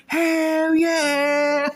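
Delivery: put-on high, loud drunken whoop; excited cheer. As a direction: cheer like a proud menace. Him cheering while crossfaded.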